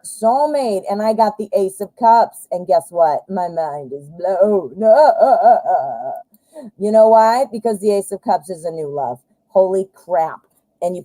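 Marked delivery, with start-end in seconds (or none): 4.9-6.2 a teary laugh of amazement; overwhelmed laugh. Real, not acted. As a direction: laugh as if about to cry with joy. Mind blown by the card.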